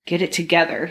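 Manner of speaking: ordinary delivery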